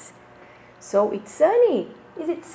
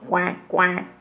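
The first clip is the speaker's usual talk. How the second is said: low put-on quacking; playful duck imitation for kids; 6 semitones lower than usual.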